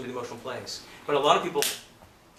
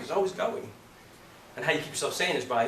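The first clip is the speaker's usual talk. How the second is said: ordinary talk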